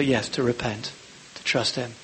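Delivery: ordinary talk, nothing marked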